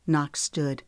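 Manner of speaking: ordinary delivery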